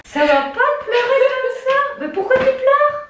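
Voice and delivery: high, emotional and loud; anxious mother asking her crying daughter. Real, not acted. Mère émue parle à sa fille en pleurs. (voix émue et aiguë)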